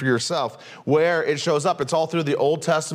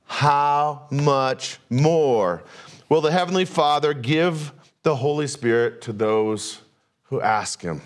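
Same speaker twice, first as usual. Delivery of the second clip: very slow "How much more", MORE stretched and falling; drawn out phrase. Shown as a demonstration. Preacher delivering the key verse, very slow. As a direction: lean hard on "more", let it fall. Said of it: "here's the phrase, you ready?".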